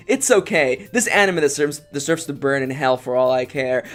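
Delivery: usual speaking voice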